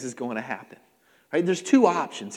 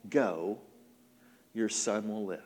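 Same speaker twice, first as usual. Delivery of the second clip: low, soft, understated reply; gentle, low-voiced dismissal; calm assurance; 8 semitones lower, 7 dB quieter than usual. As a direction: say it quietly with a pause after the first word. Jesus answers gently, smiling.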